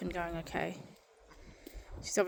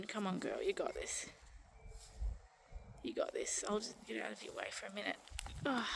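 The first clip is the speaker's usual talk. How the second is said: wildlife watching whisper; 9 dB quieter than usual. Real, not acted. Hushed commentary during lambing.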